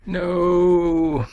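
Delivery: long, high, drawn-out no; mock-horrified refusal. Presenter answering a request to go weekly, mock-horrified drawn-out refusal. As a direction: stretch it out high in playful protest.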